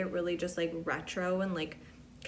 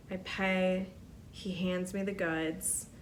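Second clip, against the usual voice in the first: ordinary delivery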